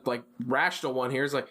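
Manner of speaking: ordinary talk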